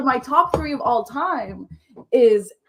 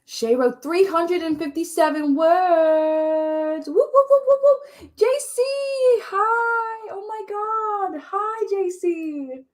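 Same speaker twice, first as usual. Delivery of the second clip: high, drawn-out whooping greeting; excited cheering; 9 semitones higher, slower than usual. Real, not acted. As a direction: cheer them on in a very high, stretched voice. Cheering a friend in chat.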